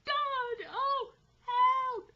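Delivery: high, loud, drawn-out wailing; broken cry for help. A narrator's reading. Man aloft begging for help, broken cries. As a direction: wail desperately in broken bursts. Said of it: "broken and, as it were, in gasps".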